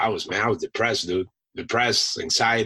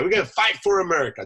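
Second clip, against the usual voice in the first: higher, fast and keen; recalling his eagerness to deploy, fast and high; eager for war; 8 semitones higher, faster than usual. Retold or imitated. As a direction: rattle it off like a pumped young Marine. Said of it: "I'm like".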